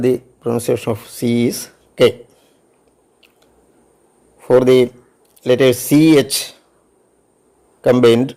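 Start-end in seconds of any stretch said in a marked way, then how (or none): none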